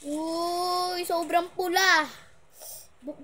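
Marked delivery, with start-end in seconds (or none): 0.1-2.1 excited. Real, not acted. Reacting to very red food.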